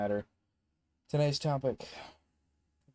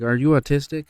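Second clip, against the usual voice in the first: loud, higher-pitched imitation of a blunt question; hard-hitting question; 5 semitones higher, 14 dB louder than usual. Girl asking her study partner outright, mock loud blurt. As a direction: blurt it out loud like a hammer blow. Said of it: "she hits the hard hammer crash".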